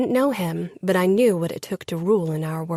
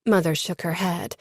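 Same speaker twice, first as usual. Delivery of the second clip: ordinary delivery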